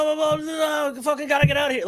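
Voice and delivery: very high, singsong frantic babble; mock panic. Critic mimics the character who has to get out, mock-frantic. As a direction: gabble nonsense way up high like someone bolting.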